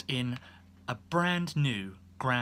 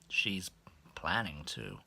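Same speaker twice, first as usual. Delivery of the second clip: low, near-whispered reply; soft, hushed and sheepish; embarrassed whisper; 8 semitones lower, 6 dB quieter, slower than usual. Retold or imitated. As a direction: mumble it, cornered.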